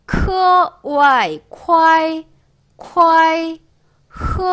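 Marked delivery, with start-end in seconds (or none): 0.8-3.6 오아이 then 콰이 joined on a high level tone; loud, held 콰이 built from 오아이; syllable drill. Shown as a demonstration. Teacher blending initial and final, syllable drill.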